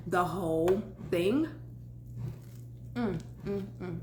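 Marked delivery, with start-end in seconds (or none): 2.9-4.0 appreciative mm while chewing. Real, not acted. Tasting food in mukbang.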